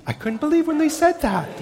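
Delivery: high-pitched laughing disbelief; real laughter breaking through. Real, not acted. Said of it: "we're laughing".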